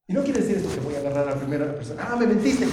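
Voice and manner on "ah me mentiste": sudden loud higher snap of accusation; mock angry outburst. Preacher acting out a congregant's angry outburst at a liar, loud and higher. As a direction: bark it like you just caught a liar.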